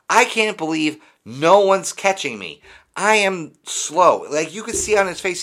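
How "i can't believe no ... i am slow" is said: higher, louder, dragging out the last words; amazed at his own slowness, slowing down; slow runner's thoughts. Retold or imitated. Mocking a slow player who can't believe nobody catches him, drawn out. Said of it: "looking around like".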